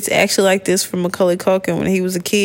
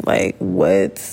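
mock deep voice; 12 semitones lower than usual. Mock disbelief, low voice.

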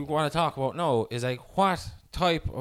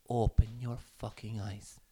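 low, slow, quiet rebuke; imitating a stern woman's hushed telling-off; retold scolding; 5 semitones lower, 8 dB quieter, slower than usual. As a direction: deliver it softly and deliberately, word by word. Retells a parent's cold scolding.

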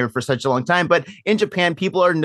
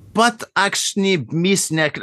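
mock German; 4 semitones higher, much slower than usual. Made-up German wine name.

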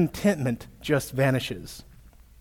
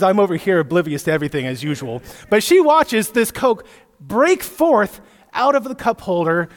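usual speaking voice

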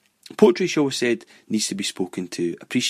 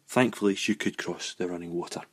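ordinary talk, nothing marked

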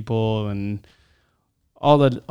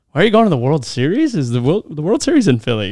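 higher, louder, rising friend's questions; incredulous questioning; 4 semitones higher, 4 dB louder, faster than usual. Retold or imitated. Friend quizzing him about the Philly trip, incredulous. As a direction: imitate a friend's rapid, puzzled questioning.

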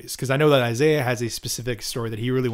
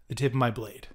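ordinary delivery

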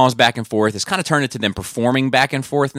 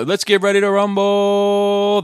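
drawn-out ring announcer call, pitched high; Michael Buffer; 11 semitones higher, slower than usual. Acted. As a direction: stretch the last word like a fight announcer. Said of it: "Michael Buffer calls him out?".

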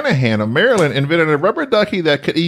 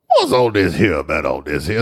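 low, gravelly Southern mumble; gravelly Louisiana accent; 9 semitones lower than usual. Shown as a demonstration. Co-host demonstrating the accent, low and gravelly Louisiana. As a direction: growl it in a thick Louisiana accent. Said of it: "It's more of a gravelly".

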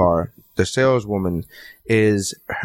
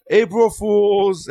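slow, drawn-out, higher call; mock yell; 7 semitones higher, much slower than usual. Family yelling the prank reveal at the brother. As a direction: stretch it out like a gotcha. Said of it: "we yell".

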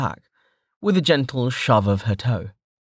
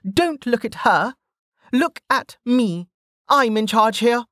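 put-on high-pitched brash young woman; Velika's voice; 14 semitones higher than usual. A narrator's reading. Velika scolding the downed sentinel, high and cocky. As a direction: toss it off, cocky and unbothered.

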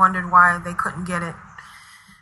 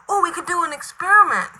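ordinary talk, nothing marked

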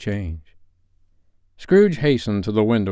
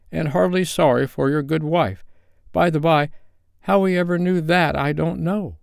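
higher-pitched father voice, fondly recounting; grateful Bob; 7 semitones higher than usual. A narrator's reading. Bob tells his family of the nephew's kindness, grateful.

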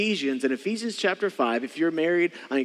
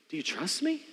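soft, hushed asking; gentle question; 2 semitones higher, 8 dB quieter than usual. Acted. God asking a hesitant believer, gently. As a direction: ask it softly, after a pause.

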